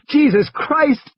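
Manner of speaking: high, slow, terrified oath; Ed Regis swears in panic at the roaring Tyrannosaur; panicked curse. Acted. Slow, high frightened cry. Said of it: "he said again".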